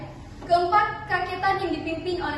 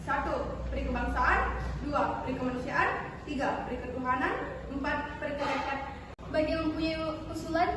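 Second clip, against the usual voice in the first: measured, quieter formal statesman; formal delegate voice; 3 semitones lower, 6 dB quieter than usual. Acted. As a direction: read the proposal like an official. Delegate reads his proposal. (suara delegasi resmi)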